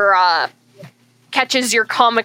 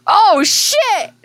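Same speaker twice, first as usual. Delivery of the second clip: surprised shout; 9 semitones higher, 3 dB louder than usual. Real, not acted. Reacting to a natural twenty.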